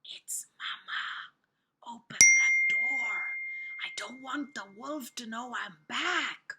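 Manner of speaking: slow, breath-only stage whisper, forceful on "open". A narrator's reading. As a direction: whisper urgently as the wolf posing as Mama.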